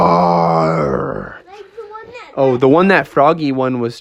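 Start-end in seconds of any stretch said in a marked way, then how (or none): 0.1-1.4 long, low beast roar; T-Rex roar. Acted. Dinosaur toy coming to life, fierce roar.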